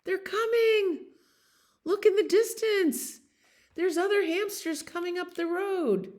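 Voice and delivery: high little hamster voice spotting friends; excited hamster. Acted. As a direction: squeak it out like a tiny excited lookout. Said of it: "And his hamster says".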